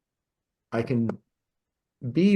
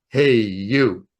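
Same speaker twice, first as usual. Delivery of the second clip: loud, drawn-out call from a powerful voice; booming male voice; 7 dB louder than usual. Retold or imitated. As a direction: boom it out, big and slow. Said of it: "a big booming male voice".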